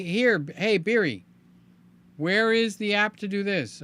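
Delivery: lightly acted voice command. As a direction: talk to the phone like an assistant. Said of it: "I could say".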